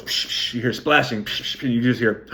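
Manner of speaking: unvoiced splash sounds; splashing psh. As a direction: hiss out the splashes. Imitating water splashing.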